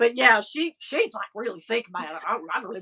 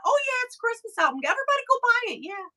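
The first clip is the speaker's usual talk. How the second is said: much higher, fast, bubbly mock Cyndi voice; imitating [name]; 11 semitones higher, much faster than usual. Singer plugging her Christmas album, high fast impression. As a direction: chirp it out quick and high like a sweet pop star. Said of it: "she was very sweet".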